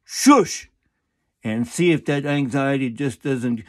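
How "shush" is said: sharp, loud, high shush; aggressive shush. Shown as a demonstration. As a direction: snap the shush out.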